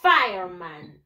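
"Fireman" drawn out slowly as FIRE-man; model English pronunciation. Shown as a demonstration. Teacher modelling for pupils to repeat, "Fireman" as slow English FIRE-man. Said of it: "En inglés se dice".

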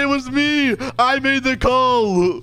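high, stretched-out play-acted plea; mock-desperate confession. Victim confessing the call to the cop, high plea. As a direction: push it high and draw out the end.

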